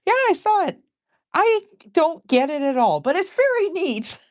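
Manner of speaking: very high put-on friend voice; imitated friend. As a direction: mimic a friend in a squeaky-high comic voice. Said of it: "my friend says".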